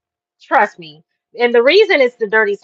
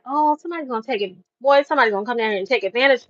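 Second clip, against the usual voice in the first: fast, softer fretting; worried rush; 5 dB quieter, much faster than usual. Retold or imitated. Visitor fretting someone will exploit the locals, fast. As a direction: rattle off the worry quickly.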